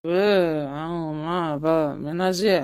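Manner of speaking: put-on slurred, broken, very slow drunk voice; drunk slurring. Drunk husband calling out to his angry wife Meenakshi, slurred and halting. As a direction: stumble through her name like a drunk man.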